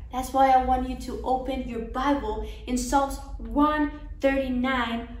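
ordinary talk, nothing marked

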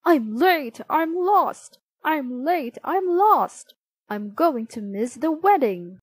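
acted yell with LOST pitched high; storybook yelling. A narrator's reading. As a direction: yell it like a late runner. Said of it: "came down the road yelling".

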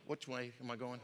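under-the-breath check of direction; muttered aside. Retold or imitated. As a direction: drop to a puzzled murmur to yourself. Acted captain loses track mid-order.

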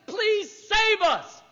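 high, slow desperate plea; pleading Israelites. Pretend. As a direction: beg God for rescue, drawn out. Israel begging God, voiced.

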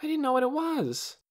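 high-pitched, pleading excuse; upset excuse. A narrator's reading. Lena never saw an egg before, upset excuse. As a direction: explain yourself in a high voice.

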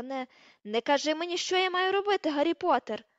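high, loud furious rebuke; outraged shout, rising in pitch; screaming in anger. A narrator's reading. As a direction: yell someone down. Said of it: "репетувала вона".

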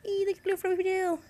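high, quick coo; pet cooing. Real, not acted. Owner admiring her bunny's fluffy tail, high cooing.

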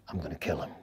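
very low, hushed menace; soft, deep-pitched vow; quiet threat. Retold or imitated. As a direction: say it under your breath, meaning it. Retold vow to kill interrogator.

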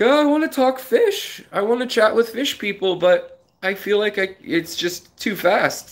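playfully voicing his past self; mock high voice. Streamer quoting himself about chat moving too fast, in a mock high voice.

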